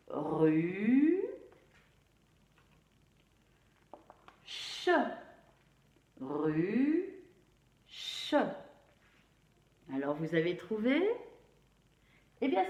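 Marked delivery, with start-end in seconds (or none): 0.1-4.9 decomposed into sounds. Shown as a demonstration. Teacher decomposing ruche so pupils can write it, sound by sound. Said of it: "on va bien décomposer pour pouvoir". 6.2-8.3 "ruche" split into a long rising rue and a separate ch; decomposed into sounds. Shown as a demonstration. Teacher repeating the word decomposed for pupils, sound by sound. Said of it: "on va bien décomposer".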